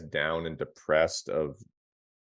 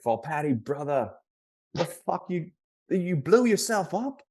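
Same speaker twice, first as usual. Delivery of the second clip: put-on high-pitched, wound-up Rhode Island accent, dragging the last line; [name]'s Rhode Island accent; 12 semitones higher than usual. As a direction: do a bad, tightly wired Rhode Island hockey coach. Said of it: "That's, that's a terrible accent".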